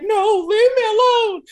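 high, laughing-crying protest; half laugh, half sob; crying refusal. Retold or imitated. Crying teen refusing the cop's horse, high teary protest. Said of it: "And I was like".

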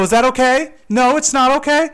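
high, loud confrontation; heated back-and-forth. Retold or imitated. Acted back-and-forth of the argument.

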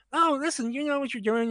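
imitating a buddy's plea; pleading friend. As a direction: wheedle him into coming.

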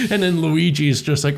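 ordinary talk, nothing marked

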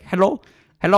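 high, lightly voiced scam support lady; a hint of a phone agent answering; terrible impression of support lady. Scammer lady answering the call, high mock phone voice. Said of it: "I'm gonna do a terrible impression".

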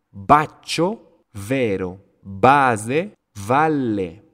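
loud, emphatic B in Baccio and Base, buzzed V in vero and valle; B versus V. Shown as a demonstration. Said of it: "notan la diferencia de B y V".

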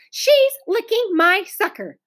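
high-pitched tattling protest; loud complaint. A narrator's reading. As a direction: complain loudly across the classroom. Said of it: "interrupted Wayne in a loud voice".

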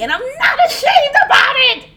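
loud, high laughing protest; a loud, high laugh while protesting; laughing shout. Real, not acted. Laughing at herself.

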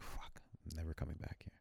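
hushed, voiceless mock cursing; whispered retold vow; muttered exasperation. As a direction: imitate a mom swearing under her breath. Mom carrying off misbehaving child.

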